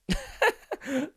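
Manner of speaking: short burst of laughter; laughing. Real, not acted. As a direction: break into a quick chuckle. Laughs at own explanation.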